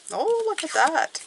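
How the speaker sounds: high-pitched surprised "oh"; pleasantly surprised. Real, not acted. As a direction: react with surprise to the card. Reacting to a drawn card.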